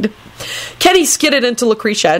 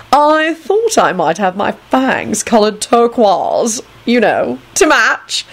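slow, affected camp delivery; effeminate, coy vampire; 4 semitones higher, slower than usual. Acted. Antonin showing off to Neville, coy and affected. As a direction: draw it out coyly, rising at the end.

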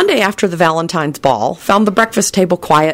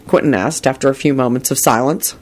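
ordinary delivery, nothing marked

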